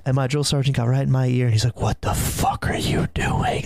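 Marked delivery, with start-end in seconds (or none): 1.8-3.6 furious but whispered, slow; angry whisper. Retold or imitated. Drill sergeant whispering angrily into a recruit's ear. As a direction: hiss it right in his ear, barely voiced. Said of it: "pissed, but he was whispering".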